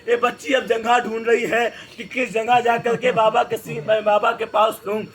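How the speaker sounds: usual speaking voice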